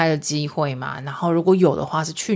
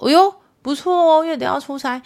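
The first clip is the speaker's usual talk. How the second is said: high, loud teasing hey not bad; playful mock congratulation, pitched well up and louder; teasing excitement; 11 semitones higher, 4 dB louder than usual. Real, not acted. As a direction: tease him with a bright high oh-ho. Teasing husband about trip.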